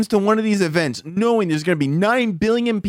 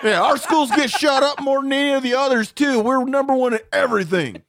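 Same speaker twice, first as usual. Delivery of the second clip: higher-pitched mock Southern bragging; Southern drawl; 5 semitones higher than usual. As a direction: boast as a clueless Southern patriot. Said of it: "issues with people with Southern draws".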